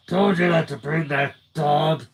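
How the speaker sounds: menacing scratchy tough-guy warning; threatening gumshoe voice. Acted. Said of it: "His tone was more than a little threatening".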